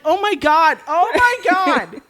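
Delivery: high, loud repeated exclamation; horrified cry. Retold or imitated. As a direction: cry out in mock horror, stretching the second. Retells own horror at the mess.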